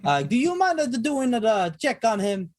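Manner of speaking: put-on high, hammy Italian accent; thick Italian accent. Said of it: "I'll use a thick Italian accent".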